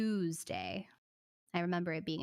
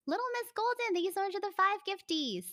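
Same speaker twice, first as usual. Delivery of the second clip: high, bright thank-you to a viewer; cheery thank-you; 10 semitones higher than usual. Real, not acted. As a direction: sing out the thanks for the gift, much higher. Thanking viewers for donated gifts.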